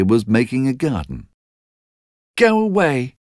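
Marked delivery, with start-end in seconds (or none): 2.4-3.2 put-on high, sharp child's cry; Mary's cry. A narrator's reading. Mary to Basil, a high childish cry. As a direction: push the boy away. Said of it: "cried Mary".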